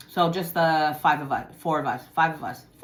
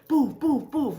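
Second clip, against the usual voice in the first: high punch sound effects; imitated punches; 7 semitones higher, slower than usual. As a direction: voice each blow as a boof. Imitates her punches landing.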